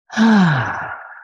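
long, falling open sigh; audible settling sigh. Real, not acted. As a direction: let out a long breath to settle. Settling to begin the meditation.

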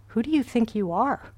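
scornful challenge, swooping up at the end; challenging tone. Acted. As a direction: throw it out as a sneering question. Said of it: "with a tone I imagine more like".

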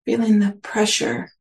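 unhurried meditation guidance with long pauses; guided meditation. Real, not acted. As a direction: gently guide listeners inward, leaving space between phrases.

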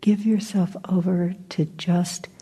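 unhurried meditation guidance, short phrases between long silences; guided meditation. Acted. Teacher guiding listeners to just be here, guided meditation.

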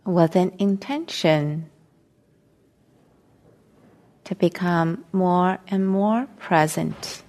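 slow meditation guidance with long silences between phrases; guided meditation. Real, not acted. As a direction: ease them into stillness, phrase by phrase, unhurried.

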